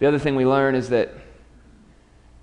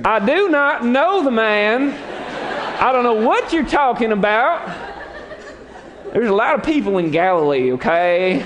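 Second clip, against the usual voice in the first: high, loud, half-laughing rant; cursing denial; 8 semitones higher, 3 dB louder than usual. Acted. Peter swearing at the accusers, loud hammed-up rant. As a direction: rant it out, higher and louder. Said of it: "he began to curse and swear".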